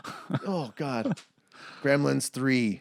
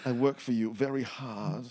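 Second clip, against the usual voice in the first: put-on softer, muddled Australian-Jersey accent; 3 semitones lower, 7 dB quieter than usual. Marla answering her boss, muddled Australian-Jersey accent. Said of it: "slip into an Australian accent".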